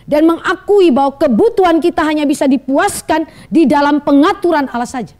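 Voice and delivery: loud, driving sermon climax; preacher hammering his message to the church, loud and intense; preaching at full force. Acted. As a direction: press the point home to the congregation.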